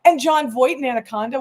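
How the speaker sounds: normal talking voice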